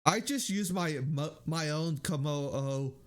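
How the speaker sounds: ordinary talk, nothing marked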